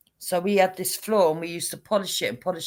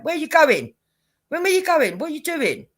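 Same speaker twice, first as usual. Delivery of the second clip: alarmed; 7 semitones higher, 4 dB louder than usual. Retold or imitated. Wife alarmed at husband with hammer.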